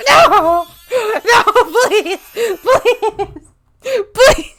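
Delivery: high loud laugh-crying begs; cry-laugh please; laughing pleading. Real, not acted. Streamer begging chat to stop, laugh-crying.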